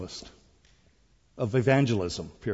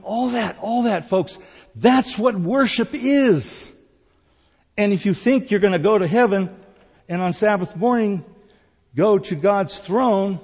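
raised, louder preacher's voice; full-force preaching; 8 semitones higher, 7 dB louder than usual. Real, not acted. Preacher urging the congregation to truly worship, full-force preaching. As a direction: drive each phrase home high and loud.

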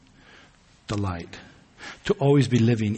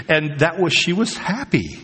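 ordinary delivery

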